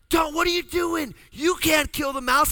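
high, loud pleading shout; mock alarmed shout. Husband voicing his wife shouting at him not to kill the mouse. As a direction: stop him before he swings the broom. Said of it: "Lynn's like".

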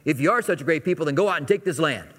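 mock challenge with a lifted voice; sarcastic fatherly dare. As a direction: needle them, daring them to go. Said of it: "kind of sarcastic at the first time".

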